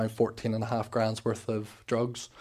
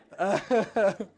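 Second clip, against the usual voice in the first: laughing; 8 semitones higher, 3 dB louder than usual. Real, not acted. Laughing at own celebration.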